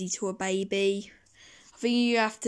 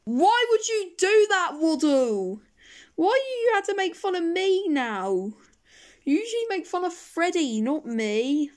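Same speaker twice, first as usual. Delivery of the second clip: hurt whine; 6 semitones higher than usual. Acted. Hurt complaint after insult.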